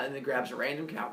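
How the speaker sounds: ordinary delivery, nothing marked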